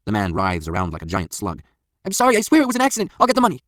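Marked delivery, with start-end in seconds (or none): 2.1-3.6 very high, loud begging; panicked pleading. A narrator's reading. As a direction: babble apologies to save your skin. Floored man begs attackers.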